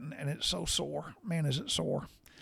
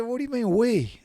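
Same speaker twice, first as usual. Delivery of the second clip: higher, sharp disbelieving wife voice; wife's indignant retort; 9 semitones higher than usual. Retold or imitated. Wife objecting to helping with the enema, high and indignant. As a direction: play his wife, thrown by the word we.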